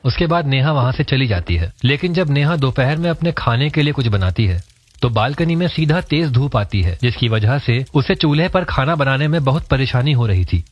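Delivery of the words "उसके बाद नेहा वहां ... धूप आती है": ordinary delivery, nothing marked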